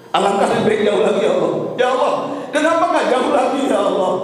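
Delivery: imitated lament climbing steadily in pitch; retold tearful plea. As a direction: plead to Allah, rising toward a high cry. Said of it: "suamiku berkata sambil melihat ke atas menangis".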